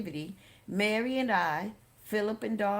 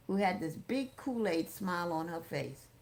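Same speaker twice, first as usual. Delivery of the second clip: plain reading voice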